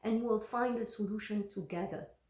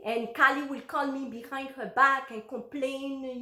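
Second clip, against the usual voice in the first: ordinary talk, nothing marked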